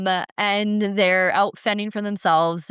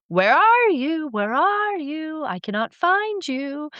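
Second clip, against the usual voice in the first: calling Enlil; 9 semitones higher than usual. Retold or imitated. Enlil searching for naked humans, high calling voice. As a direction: call out searching the garden.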